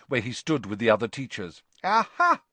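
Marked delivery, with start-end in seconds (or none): 1.8-2.4 raised, higher, louder announcement; hearty proclamation; Dumbledore calling out. A narrator's reading. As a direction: call over a crowd from the back. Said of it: "Dumbledore called out from the back row".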